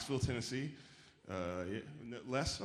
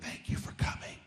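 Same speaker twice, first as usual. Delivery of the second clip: breathy whispered thanks; old lady's whisper; 4 dB quieter than usual. Retold or imitated. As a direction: whisper it, clutching his hand.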